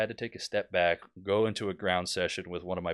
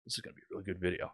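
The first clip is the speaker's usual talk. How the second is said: soft, rapid aside to oneself; inner thought; 3 semitones lower, 12 dB quieter, much faster than usual. Retold or imitated. Student watching a tutorial begin, quiet inner thought. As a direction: drop it low in volume and hurry through. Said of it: "in the back of my head".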